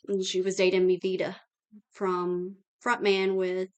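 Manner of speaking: usual speaking voice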